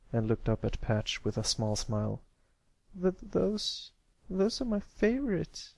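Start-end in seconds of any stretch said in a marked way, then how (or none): 3.0-5.7 put-on high, halting child's voice; sniffling little boy. A narrator's reading. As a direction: stammer it in a small, high voice. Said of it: "Pipsqueak sniffled again".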